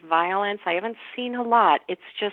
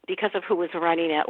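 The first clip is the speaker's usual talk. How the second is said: ordinary talk, nothing marked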